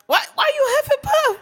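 very high playful question; high teasing. Real, not acted.

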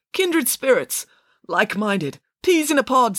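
high, halting suggestions; hinting hopefully. Acted. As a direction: offer each phrase as a hopeful hint. Parker hinting romantically at Friday.

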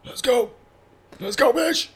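high-pitched fighting call; retold hyped challenge. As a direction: egg someone on from a distance. Re-enacts challenge to driver.

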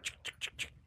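voiceless tongue-and-teeth shutter clicks; quiet imitated clicking noises; imitated camera clicks. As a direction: mimic rapid camera snapping with the mouth. Imitates dad snapping photos.

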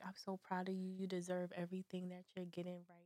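very soft, low murmur; tender retold praise. Friend retells what a lover told her in bed, tender and hushed. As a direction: imitate a lover's hushed, intimate reassurance.

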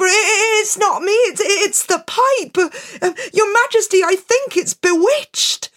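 high, stammering, panicked excuse; frightened stammer. Acted. Piper begging the king.

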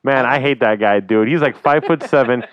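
loud, fired-up rant; heated rant. Real, not acted. Hockey fan ranting loudly about a hated player, fired up. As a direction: vent your hatred of a rival player. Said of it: "Let that anger flow through your body".

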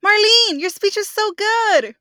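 over the top praise for Marlene's speech; mock gushing praise. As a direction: gush over the speech like a fan. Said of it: "vine quote voiced".

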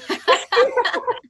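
high, loud laugh of delight; real laugh. Real, not acted. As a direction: burst out laughing at the memory. Delighted laugh after quote.

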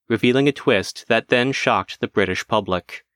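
usual speaking voice

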